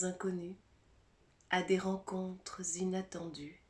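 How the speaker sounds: measured poem reading with long pauses between phrases; poem recitation. Acted. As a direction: recite it unhurriedly, letting each image settle. Reads a poem aloud.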